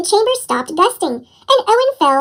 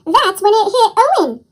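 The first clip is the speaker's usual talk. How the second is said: plain reading voice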